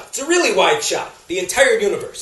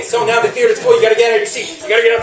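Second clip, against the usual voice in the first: usual speaking voice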